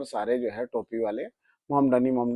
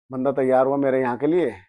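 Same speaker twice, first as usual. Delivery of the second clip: ordinary delivery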